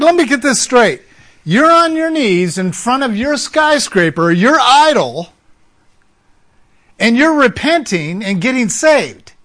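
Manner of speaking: high, loud, incredulous recap. Retold or imitated. Counselor recapping the repentance to the mafia man, incredulous. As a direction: spell it out in disbelief.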